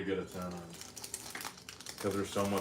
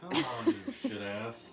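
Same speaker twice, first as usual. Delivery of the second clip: high teasing yell. Real, not acted. Teasing outburst back.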